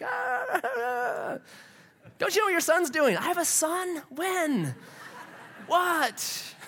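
high-pitched, hammed-up wail and startled questions; mock frantic. Quoting Charity and a startled parent, mock frantic. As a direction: keep it very high, a comic imitation of panic. Comic retelling, frantic character voices.

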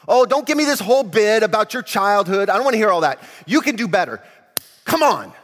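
loud, high scolding rant; angry Jesus. Acted. Preacher voicing an angry Jesus at a teen, loud scolding. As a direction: berate him like a let-down father. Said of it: "The first word these young people came up with was angry".

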